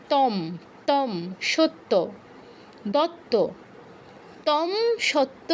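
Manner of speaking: তম, সত্য, দত্ত said slowly with the consonant held double; doubled consonant. Shown as a demonstration. Teacher slowly showing the class a shared sound. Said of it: "এগুলোর মধ্যে একটু মিল আছে".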